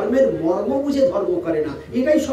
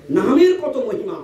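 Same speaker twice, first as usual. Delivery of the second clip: ordinary talk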